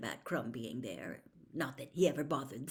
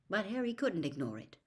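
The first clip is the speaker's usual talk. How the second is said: ordinary delivery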